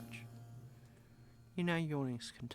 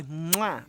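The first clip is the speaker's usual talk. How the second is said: kiss noise; 9 dB louder than usual. Shown as a demonstration. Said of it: "humans do the kissy, kissy noise".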